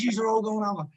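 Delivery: raging teammate. Retold or imitated. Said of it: "Oh, he was raging".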